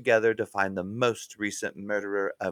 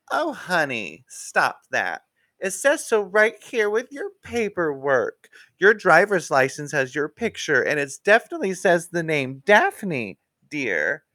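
high, louder sugary scolding; patronizing Wiener; 6 semitones higher, 5 dB louder than usual. Acted. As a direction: talk down to her sweetly but firmly. Wiener condescending.

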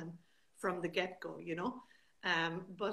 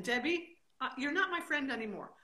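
loud, high-pitched pretend telling-off; mock outrage; 9 semitones higher, 12 dB louder than usual. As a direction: scold your friend in exaggerated exasperation. Mock quarrel with absent friend.

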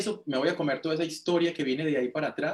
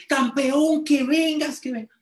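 orden aguda y fuerte, sin aceptar excusas; insistencia a gritos; 12 semitones higher, 7 dB louder, slower than usual. Retold or imitated. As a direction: insiste gritando para arrastrarlo. Lina insiste en que venga. (imitando un llamado apremiante, muy agudo)

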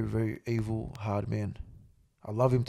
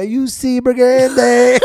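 drawn out catchphrase with a laugh, slow; 10 semitones higher, 17 dB louder, much slower than usual. Retold or imitated. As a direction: stretch the name out grinning. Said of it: "I always say it".

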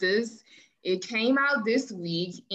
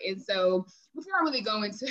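ordinary talk

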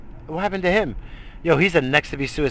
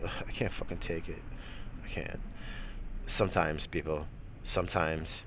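much softer, lower, worn out; drained mutter; 9 semitones lower, 14 dB quieter than usual. Real, not acted. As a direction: sag after the anger. Exhausted after rant.

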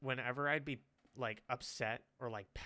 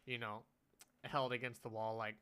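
normal talking voice

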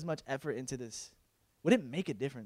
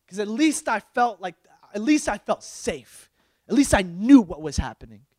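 raised, insistent voice; ranting complaint to God; 5 semitones higher, 8 dB louder than usual. Acted. Believer complaining to God about lost slavery, ranting. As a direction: rant it out, leaning hard on each key word.

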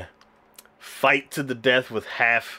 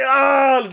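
high, loud "ah" of embarrassment; embarrassed mock yell; 13 semitones higher, 6 dB louder than usual. Boy caught peeking by her, embarrassed mock yell. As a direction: cry out as if caught red-handed. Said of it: "super embarrassed".

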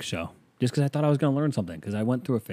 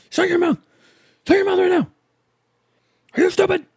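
much higher, loud hammed-up yell; dad yelling; 8 dB louder than usual. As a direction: bellow at the kid like a furious father.

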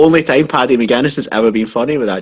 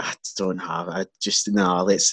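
quiet deflated; 4 semitones lower, 7 dB quieter than usual. Retold or imitated. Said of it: "And then I'm like".

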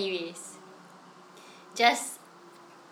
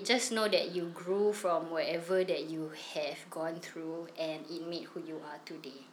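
whispering; 4 semitones lower, 9 dB quieter than usual. Real, not acted. Sincere message to listeners.

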